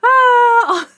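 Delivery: loud, high yelp; high cry out. Real, not acted. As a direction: let out a sharp cry. Cry-like exclamation.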